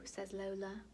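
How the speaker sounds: usual speaking voice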